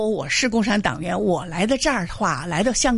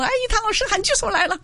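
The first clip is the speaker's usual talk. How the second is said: mock alarm; 12 semitones higher, faster than usual. Colleagues mocked as he arrives.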